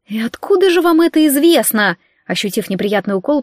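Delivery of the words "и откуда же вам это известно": ordinary delivery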